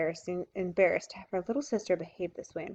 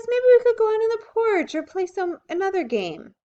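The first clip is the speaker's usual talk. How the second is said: much higher, louder eager plea; pleading girl; 12 semitones higher, 8 dB louder than usual. A narrator's reading. Beezus begging Henry to stay, high and loud. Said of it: "begged Beezus".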